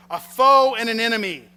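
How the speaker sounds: high, loud denouncing cry; accusing cry. Acted. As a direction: point the finger at the enemy. Esther accuses Haman at dinner.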